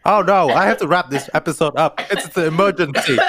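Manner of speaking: playful fake alarm, laughing; mock panic. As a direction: act flustered as a joke. Jokey panic about wrapping up.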